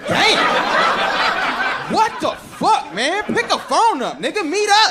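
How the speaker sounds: high, shouted disbelief; exasperated outrage. Acted. Comic scolding people who email too much, loud high exasperation. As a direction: yell it out, exasperated.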